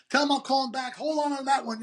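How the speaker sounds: busy dealmaker. Retold or imitated. Dad juggling two phones.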